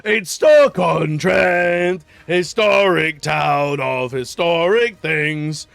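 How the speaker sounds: slow, loud proclaiming mascot voice; loud, slow proud proclamation about Stoke-on-Trent; cat puppet announcer. Retold or imitated. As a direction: bellow it like a kids' TV cat. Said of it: "the little cat door and goes".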